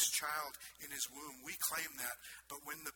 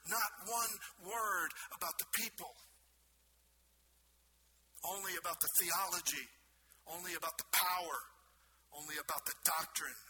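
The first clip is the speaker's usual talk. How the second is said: raised, insistent pulpit voice; impassioned preaching; 4 semitones higher, 6 dB louder than usual. Acted. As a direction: drive each repeated phrase home like an indictment. Preacher at full force.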